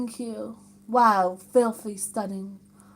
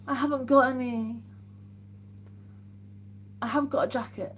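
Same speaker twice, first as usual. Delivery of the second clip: normal talking voice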